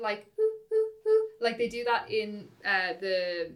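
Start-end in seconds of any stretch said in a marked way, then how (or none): 0.4-1.3 high, sharp peaked oohs; short sharp oohs; staccato oohs. Shown as a demonstration. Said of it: "little sharp ones".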